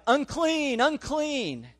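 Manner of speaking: high call of warning; crying out. Retold or imitated. Leper walking the road warning people away, high calling out. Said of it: "they had to cry out".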